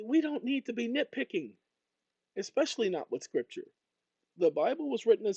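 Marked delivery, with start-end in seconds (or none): none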